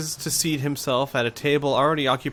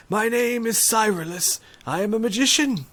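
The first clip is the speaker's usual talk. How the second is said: put-on high, meek old magician voice; apologetic little old man; shaky old voice; 10 semitones higher than usual. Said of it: "he says meekly".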